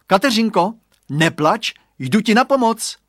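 high, loud determined shout. A narrator's reading. Boy shouts to crying friend, high and loud. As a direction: cry out bravely to the rescue. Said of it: "vykřikl".

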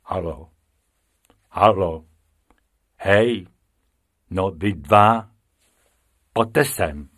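low-voiced penguin hailing; deep, commanding summons. A narrator's reading. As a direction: call them over in a deep voice. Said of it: "ozval hluboký hlas".

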